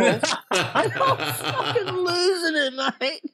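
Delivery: slow, laughing through the words; laughing hard. Real, not acted. Mate retelling a prank he lost it over, laughing hard. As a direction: struggle to talk through real laughter.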